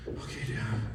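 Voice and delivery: soft, low, meek reply; meek child. Retold or imitated. Unnerved son answering his intense dad, meek and quiet. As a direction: answer as a cowed little boy.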